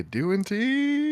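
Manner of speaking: squeaky teasing greeting; playful high call. Pretend. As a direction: sing her name up high.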